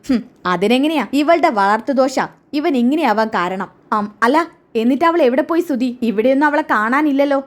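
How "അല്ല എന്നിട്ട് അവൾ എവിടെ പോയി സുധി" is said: ordinary delivery, nothing marked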